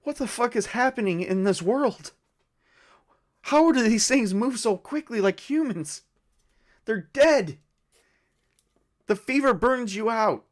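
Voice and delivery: high-pitched acted distress; tearful disbelief. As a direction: voice climbing high, overwhelmed and upset. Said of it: "she's in tears".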